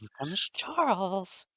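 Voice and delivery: mock-sinister singsong announcement; creepy Charles. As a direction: draw it out like a horror-film taunt. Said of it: "'cause that's a creepy name".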